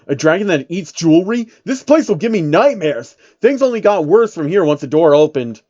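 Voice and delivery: ordinary delivery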